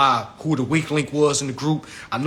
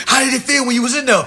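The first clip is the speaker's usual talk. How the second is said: imitating homeboy; 8 semitones higher, 5 dB louder, faster than usual. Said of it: "Your homeboy telling you".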